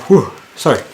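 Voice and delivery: talking through a yawn. Real, not acted. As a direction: yawn cutting into the words. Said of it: "That was the quickest yawn in the world".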